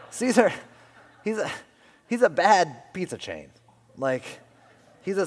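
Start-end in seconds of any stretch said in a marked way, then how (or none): 0.0-3.5 laughing. Real, not acted. Laughing at his own joke.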